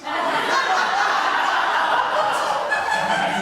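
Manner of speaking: very high unvoiced cackling; breathless shrieking laugh; real laugh. Real, not acted. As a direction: lose it laughing. Laughing at the email.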